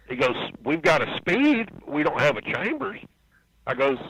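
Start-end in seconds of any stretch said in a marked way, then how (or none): 0.6-3.0 higher-pitched, mildly baffled reply; retold puzzled denial. Crewman answering a visitor at Gibbs area, puzzled. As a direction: replay a confused denial, pitched up.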